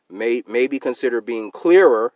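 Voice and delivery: loud, slow, every word of "maybe consider being clearer" stressed; all-caps reading. Shown as a demonstration. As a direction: read it out like a typed shout. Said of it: "and I put that in all caps".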